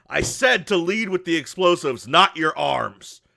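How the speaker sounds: loud, emphatic telling-off; scolding shout. Acted. Grant to workers bitten by rats, loudly scolding. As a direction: holler the reprimand, dragging out the last words.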